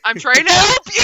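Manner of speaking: exasperated shout. Acted. In-character quarrel over guns.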